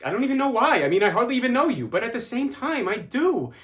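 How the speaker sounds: high-pitched emotional rush; overwhelmed confession. Acted. Confessing love to a near stranger, overwhelmed and pleading. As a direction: blurt it out, voice riding high.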